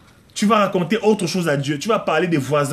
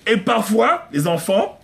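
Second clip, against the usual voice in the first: normal talking voice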